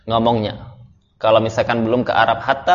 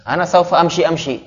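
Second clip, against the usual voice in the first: higher, playful parody of a student's half-Arabic; mock Arabized Indonesian; 6 semitones higher than usual. Teacher mocking students who speak Arabized Indonesian, playful parody. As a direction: tease the students by lifting the pitch on saufa. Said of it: "tapi Indonesia diarabkan".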